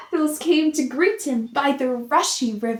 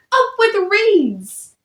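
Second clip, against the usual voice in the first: higher, louder, slow hoot; happy hoot; 7 semitones higher, 5 dB louder than usual. A narrator's reading. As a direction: stretch out a delighted hoot. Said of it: "And they hooted".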